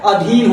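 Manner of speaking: अधीन with a short opening a; corrected pronunciation. Shown as a demonstration. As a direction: say अधीन as "a-dheen". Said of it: "अधीन होता है".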